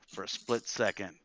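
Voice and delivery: ordinary delivery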